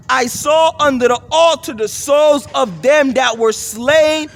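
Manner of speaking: emphatic, measured Bible reading; scripture reading. Acted. Preacher reading the fifth seal verse, emphatic scripture reading. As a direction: proclaim the verse, punching the key words. Reads scripture aloud to listeners.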